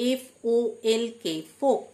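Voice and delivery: ordinary delivery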